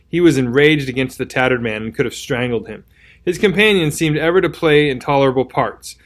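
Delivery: ordinary delivery, nothing marked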